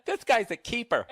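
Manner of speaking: high, loud mock admiration; mock gushing. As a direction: gush over him sarcastically. Mocking boyfriend, loud.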